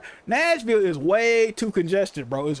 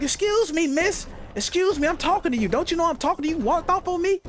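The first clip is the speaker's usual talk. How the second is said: pushy saleswoman; 7 semitones higher than usual. Retold or imitated. Retold pushy saleswoman calling out.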